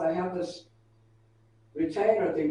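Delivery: ordinary talk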